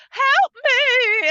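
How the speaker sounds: shrill, high, loud mock cry for help; mock scream. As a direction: yell for help like a horror victim. Victim screams in horror scene.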